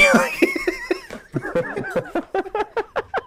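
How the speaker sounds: very high, wheezy laughing fit; laughter. Real, not acted. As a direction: collapse into laughter after your punchline. Cracking up after the punchline.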